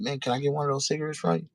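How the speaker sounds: high, fast, friendly ask for a cigarette; polite young hustler asking. Retold or imitated. Doobie asking the crackhead for a cigarette, high and polite. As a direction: sweet-talk a stranger for a smoke, quickly. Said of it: "He was just like".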